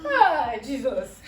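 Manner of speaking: slow laughing groan. Real, not acted. After bitter kola.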